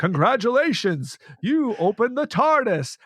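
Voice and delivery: high, booming-host style congratulations; voice of the tardis. Retold or imitated. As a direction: imitate a time machine greeting its visitor. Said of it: "he pretends he's the voice of the tardis".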